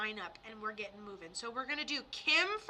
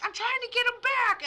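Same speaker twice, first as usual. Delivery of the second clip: much higher, louder hammed-up plea; mock desperate mom; 11 semitones higher, 8 dB louder than usual. Mock desperate mom vowing to get her son back. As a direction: ham up a frantic grieving mother. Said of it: "I'm glad you weren't like".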